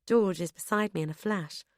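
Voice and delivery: usual speaking voice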